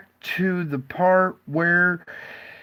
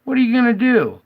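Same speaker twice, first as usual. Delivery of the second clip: excited; 7 semitones higher than usual. Real, not acted. Reacting to skunk stuck on pond.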